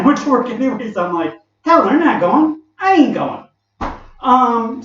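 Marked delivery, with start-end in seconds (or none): 1.6-3.5 defiant. Retold or imitated. Defiant younger self quoted.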